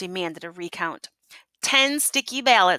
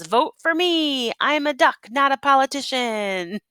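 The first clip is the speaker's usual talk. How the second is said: higher, bouncy campaign pitch; a higher, eager duck on the campaign trail; 6 semitones higher than usual. Acted. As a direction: sell it like a duck candidate. Duck's campaign poster.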